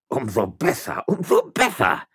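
high, gloating repetition; spiteful delight; malicious glee. A narrator's reading. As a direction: gloat wickedly, rising on the repeat. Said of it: "mit boshaft glänzenden Augen".

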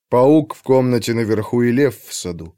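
ordinary delivery, nothing marked

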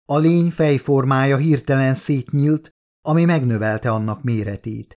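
usual speaking voice

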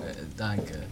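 ordinary delivery

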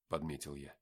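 ordinary delivery, nothing marked

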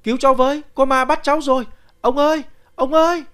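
high, loud cries for help; terrified yelling. Acted. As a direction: scream for help in rising panic. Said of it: "Tôi hét lên".

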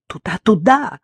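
high, loud, desperate cry down the phone; anguished shout. Acted. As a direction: yell it as if breaking down. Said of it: "крикнула она надрывно".

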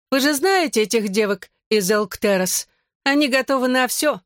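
high-pitched, mocking announcement; loud public taunt. A narrator's reading. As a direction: spread gossip to the whole room. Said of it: "Сказано было громко". (язвительно, напоказ, высоким голосом)

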